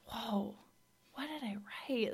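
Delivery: soft, breathy whisper of disbelief; hushed amazement. Retold or imitated. Speaker recalling her own writing, whispered amazement. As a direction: whisper it like rereading your own words. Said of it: "I'm just like".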